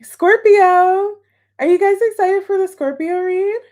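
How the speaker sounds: loud, high sing-out to the crowd; excited hype. Real, not acted. Tarot reader rousing viewers for the next read, excited. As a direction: rev up the audience, pitched high and bright-eyed.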